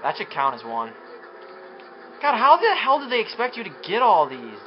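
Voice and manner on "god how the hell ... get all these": higher-pitched, exasperated griping; exasperated complaint. Real, not acted. Player griping about badly placed targets, exasperated. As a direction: protest in real disbelief.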